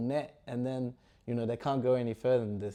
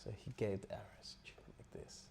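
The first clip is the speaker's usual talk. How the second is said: whispered, very soft; hushed aside; 3 semitones lower, 15 dB quieter than usual. Real, not acted. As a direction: drop to a whisper. Teller trails into hushed aside.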